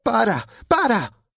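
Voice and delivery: high-pitched shriek; frantic squeal of protest. A narrator's reading. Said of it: "chillaba Dakota".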